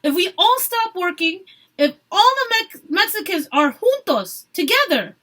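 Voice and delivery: high, loud plea for unity; rally them together; passionate shout. A narrator's reading. Marta continues yelling.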